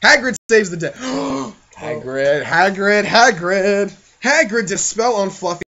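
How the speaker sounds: ordinary talk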